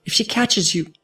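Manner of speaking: high frightened plea; a high worried warning; panicked. Acted. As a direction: fear breaking through. Fleur panics.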